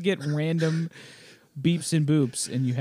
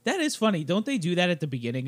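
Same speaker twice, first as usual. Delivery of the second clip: usual speaking voice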